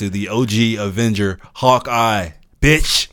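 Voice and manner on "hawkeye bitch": loud, emphatic shout-out, pitched up on the last word; building to a loud, higher final word; Jesse Pinkman. Acted. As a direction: punch each word out loudly. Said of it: "That was my, um, Jesse Pinkman impression".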